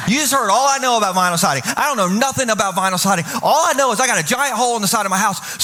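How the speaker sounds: ordinary talk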